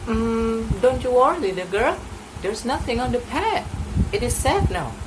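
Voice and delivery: comforting hunter. Acted. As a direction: soothe the little girl.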